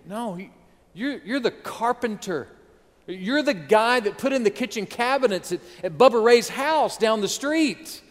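hammed-up skeptical crowd voice; mocking townsfolk. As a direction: scoff like the folks down the street. Preacher voicing scoffing townsfolk.

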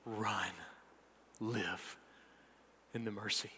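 low, soft benediction with long pauses; hushed preaching. Real, not acted. Preacher sending believers to live in mercy, hushed. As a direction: send them out quietly, word by word.

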